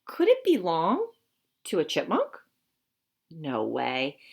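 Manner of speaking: lively, expressive storybook voice; reading to children. Acted. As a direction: read it to a class of young kids. Reads picture book to children.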